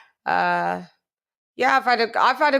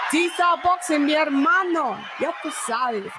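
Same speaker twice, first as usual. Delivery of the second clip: hype shout-out; 5 semitones higher than usual. Real, not acted. Hyped roll call shout.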